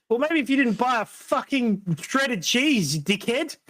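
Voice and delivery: heated high rant. Real, not acted. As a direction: tear into them, heated. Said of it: "take it easy, old man".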